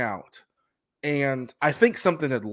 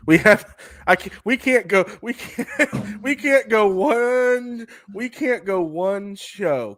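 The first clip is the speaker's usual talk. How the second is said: laughing exasperation; 8 semitones higher, 4 dB louder than usual. Real, not acted. Co-host teases him about his favorite wrestler, laughing exasperation. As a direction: protest through laughs, stretching the words out high.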